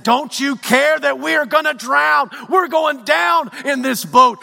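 frantic shouted plea of the disciples in the storm; terrified disciples shouting over the storm; panicked cry. Retold or imitated. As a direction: yell it like the boat is sinking. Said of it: "They cried out and they said".

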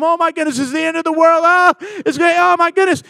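high, rising mock panic; acted panic. Preacher mimicking us focused on the problem, high panic. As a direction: wail it as if all is lost.

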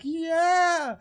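high rock-star scream. Retold or imitated. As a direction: let out a wild victory yell. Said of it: "he starts screaming".

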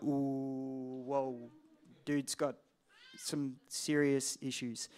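a lightly voiced stunned congregation, hushed; hushed shocked crowd. Congregation reacting to the preacher's claim, hushed and awed. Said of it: "Everyone would be like".

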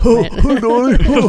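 put-on high, loud old man voice; Scotty Winkler voice. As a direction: squawk it like old Donnie. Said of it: "Do your Scotty Winkler voice".

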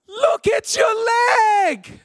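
high, hammed-up horrified holler; high, stretched-out warning shout; mock alarmed yell. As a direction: imitate a panicked mate calling downhill. Horrified friend yelling downhill.

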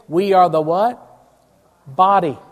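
ordinary delivery

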